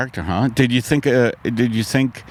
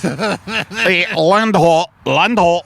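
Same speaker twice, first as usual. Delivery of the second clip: slow, high slurred Irish "Land ho"; drunk Irish captain; 5 semitones higher, much slower than usual. Pretend. Drunk Irish captain sighting land, hammed-up slur. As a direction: bellow "Land ho" like a soused sea captain. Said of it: "impression of a drunk Irishman".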